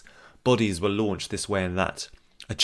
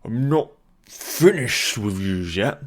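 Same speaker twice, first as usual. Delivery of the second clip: put-on slow, slurred, loud drunk; deep drunken voice; 3 semitones higher, 6 dB louder, slower than usual. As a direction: growl it out like a drunk. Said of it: "Deep, drunken voice".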